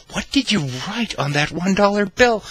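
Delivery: high, loud imitation of the friend; amused friend. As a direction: demand an answer in her high voice. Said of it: "kinda snickering and laughing a little bit".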